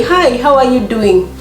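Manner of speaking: ordinary talk, nothing marked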